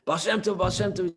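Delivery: high, urgent mock calling-out; imitating out of breath. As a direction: act out calling after someone while winded. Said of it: "he's out of breath, this guy".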